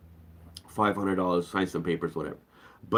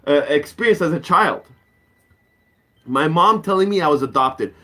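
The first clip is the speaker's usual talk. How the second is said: ordinary talk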